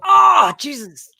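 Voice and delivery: a real groan at a question you dread; exasperated oh Jesus. Real, not acted. Groaning at a quiz choice.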